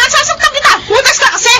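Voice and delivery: high, screaming rage; furious shout. Acted. Wife screaming furiously at her cheating husband.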